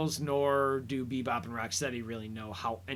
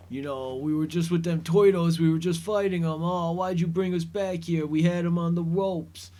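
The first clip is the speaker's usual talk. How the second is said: hammed-up New York goon accent, higher and louder; 5 semitones higher, 6 dB louder than usual. Henchmen excitedly reporting back to their boss, loud goon accent. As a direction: play a dim-witted New York thug, loud and eager. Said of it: "goon accent there".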